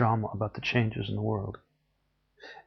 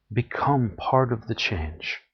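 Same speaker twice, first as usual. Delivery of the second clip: ordinary delivery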